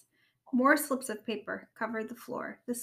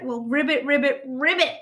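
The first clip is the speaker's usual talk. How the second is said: mocking; 4 semitones higher, 8 dB louder than usual. Rabbit mocking frog.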